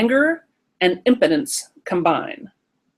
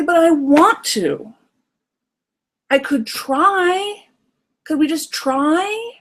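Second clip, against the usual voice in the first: put-on much higher, slow childlike plea; pleading little kid; 8 semitones higher, much slower than usual. Inner child begging parent to let them try, high and slow. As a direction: beg like a small child. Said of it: "You could say".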